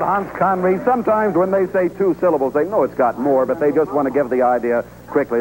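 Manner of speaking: normal talking voice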